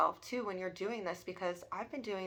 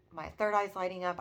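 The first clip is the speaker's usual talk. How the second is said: normal talking voice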